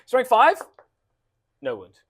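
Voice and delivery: usual speaking voice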